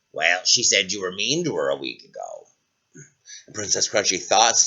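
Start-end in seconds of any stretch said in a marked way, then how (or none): 0.1-2.5 low, softer frog voice; deep-voiced creature explaining; talking frog. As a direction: answer gravely as a frog. Frog answers princess.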